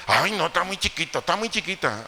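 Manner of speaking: lightly voiced indulgent mom; mock doting mother. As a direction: whine that she's just little.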